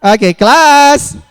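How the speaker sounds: high, loud, drawn-out class call; attention call. Real, not acted. Trainer regains the class's attention, loud high call. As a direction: shout the room back to order.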